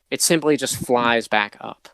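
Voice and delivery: ordinary delivery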